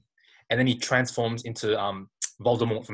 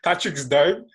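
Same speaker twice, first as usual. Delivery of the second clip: laughing; 11 semitones higher, 6 dB louder, slower than usual. Real, not acted. Laughing retelling absurd dream.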